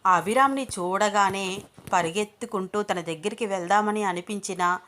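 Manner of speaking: usual speaking voice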